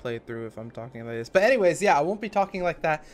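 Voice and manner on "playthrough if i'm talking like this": low, much softer, mumbled demo; mock mumbly let's-play voice. Shown as a demonstration. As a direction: mumble it into your chest to show the old habit. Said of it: "when I talk like this".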